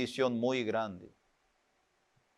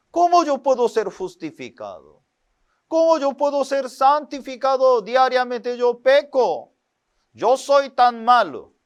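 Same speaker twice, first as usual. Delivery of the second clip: anguished self-accusation, louder and higher; guilty lament; 8 semitones higher, 4 dB louder than usual. Retold or imitated. Preacher voicing a guilty believer's anguished lament. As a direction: cry out your own unworthiness.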